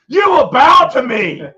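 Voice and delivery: loud, high imperious shout; hammed-up king. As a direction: command the subjects with mock grandeur. King commanding his subjects.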